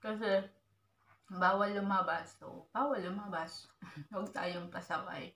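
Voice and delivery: talking with food in mouth; words between bites; chewing while talking. Real, not acted. Seen 0: Ate at -7; cheeks bulge mid-speech at 1.4, 3.4.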